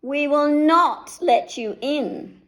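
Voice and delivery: high, slow, loud piggy refusal; little pigs. A narrator's reading. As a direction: refuse him firmly, like tiny pigs. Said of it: "said the three little pigs".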